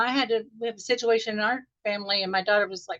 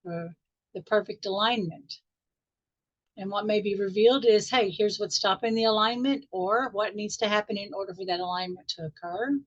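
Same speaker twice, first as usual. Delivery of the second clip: guided meditation. Acted. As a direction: ease the group gently back into the room.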